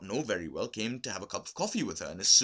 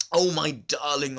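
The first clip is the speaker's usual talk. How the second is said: higher, louder, slow grieving cry; sobbing; 5 semitones higher, 7 dB louder, slower than usual. A narrator's reading. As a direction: wail it out in grief. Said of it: "Olga sobbed".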